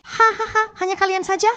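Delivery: mocking villain laugh.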